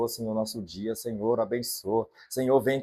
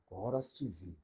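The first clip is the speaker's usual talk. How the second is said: softer voice of God; 7 semitones lower, 14 dB quieter than usual. Acted. God turns and speaks.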